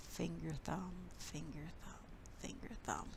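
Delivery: fading to a whisper. Shown as a demonstration. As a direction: let the count fade into a whisper. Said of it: "the daddy long legs".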